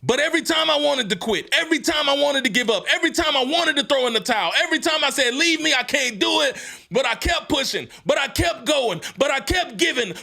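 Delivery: full-force motivational shout; fiery preaching. Acted. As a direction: preach it like a rallying cry.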